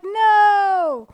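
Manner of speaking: sudden high, loud cry of no; mock-horrified yelp; exclaiming no. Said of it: "I'm like".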